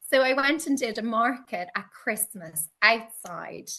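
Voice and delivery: usual speaking voice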